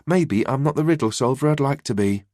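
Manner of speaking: usual speaking voice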